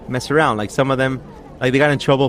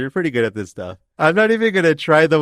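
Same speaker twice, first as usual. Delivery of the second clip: ordinary delivery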